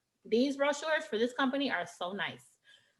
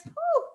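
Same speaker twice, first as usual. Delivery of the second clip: high squeaky whew of delight. Real, not acted. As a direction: let out a blissful whew. Remembering amazing food.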